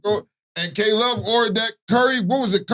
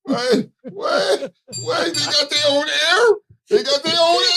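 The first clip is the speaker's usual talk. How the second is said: high-pitched disbelieving wait, wait; pitched-up astonished question; incredulous; 9 semitones higher, slower than usual. Real, not acted. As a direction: stop them in stunned disbelief. Disbelief at curry air.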